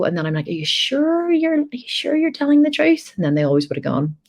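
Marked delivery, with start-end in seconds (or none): 0.5-3.1 put-on mother coaxing her child to admit it; gentle questioning mum. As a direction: coax the truth out of a little one.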